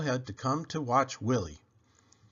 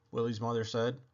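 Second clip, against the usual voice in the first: plain reading voice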